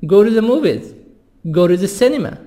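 "Go to" with T tapped to D, gor u; flap T. Shown as a demonstration. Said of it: "T صف D".

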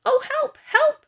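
very high squeal for help; squealing pig. A narrator's reading. First pig cries for help as the house goes, high squeal. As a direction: squeal it like a panicked little pig. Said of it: "squealed the first little pig".

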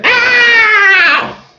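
squealing deer in mock pain; high, drawn-out comic yelp; Bambi's daddy. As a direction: shriek like a wounded cartoon animal. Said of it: "And poor Bambi's daddy went".

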